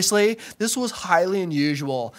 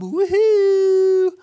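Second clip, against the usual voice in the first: high, loud whoop of joy; sudden squeal of delight; excited whoop; 5 dB louder than usual. Acted. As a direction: cheer it out like a happy surfer. Cheering at getting a job.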